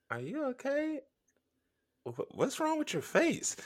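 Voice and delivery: high, soft, wary question; hushed mock-worried friend; imitated concern. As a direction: ask it small and high, cautiously.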